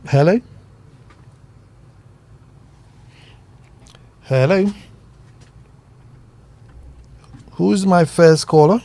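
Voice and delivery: loud calls into a phone line; shouting into a phone. Real, not acted. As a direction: call out down a bad line. Calling to caller on line.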